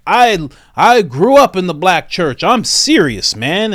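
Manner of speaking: mock Biden. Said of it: "he'll be like".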